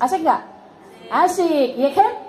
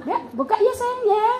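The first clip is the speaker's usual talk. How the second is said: higher, sugary coaxing; forced sweetness; 7 semitones higher than usual. Acted. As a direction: coax sweetly while holding back anger. Mother suppressing anger sweetly.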